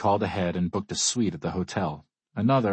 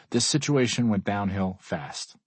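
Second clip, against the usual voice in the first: ordinary delivery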